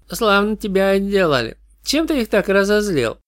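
put-on high, detached young girl; cool, unimpressed goth teen. Goth girl speaks to detective.

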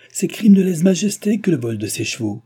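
high, loud accusation; shouted protest. A narrator's reading. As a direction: cry out in outrage. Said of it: "cria le maître de poste".

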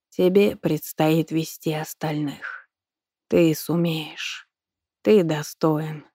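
slow, solemn dying teacher; frail old man. A narrator's reading. Dying teacher blesses student.

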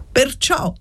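spiteful, high-pitched interjection; spiteful old man. A narrator's reading. Asthmatic old man starts to object, spitefully. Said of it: "con la sua voce dispettosa".

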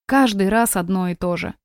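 ordinary delivery, nothing marked

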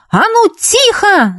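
very high, stretched command; yelling. A narrator's reading. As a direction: yell over the din for silence. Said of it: "заорала Юлька". (орёт, требуя тишины)

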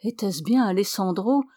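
usual speaking voice

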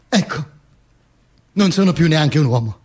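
ordinary delivery

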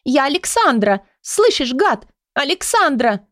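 high screaming after him; hysterical shriek. A narrator's reading. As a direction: shriek the name down the stairs. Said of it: "истерический визг".

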